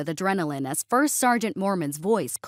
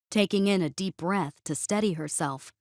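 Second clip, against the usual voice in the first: ordinary delivery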